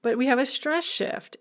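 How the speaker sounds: teacher faking astonishment at the noun; mock amazed whoa. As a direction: act stunned by the stress shift. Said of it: "We're like".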